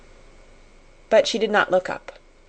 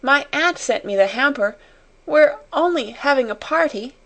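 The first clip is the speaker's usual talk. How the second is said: higher-pitched pleading, mildly upset; tearful plea; 7 semitones higher than usual. A narrator's reading. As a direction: plead in a raised voice, a touch tearful. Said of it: "she sobbed".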